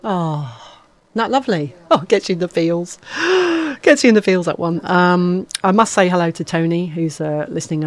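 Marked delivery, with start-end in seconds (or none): 3.0-3.8 emotional gasp. Real, not acted. Moved by the quote.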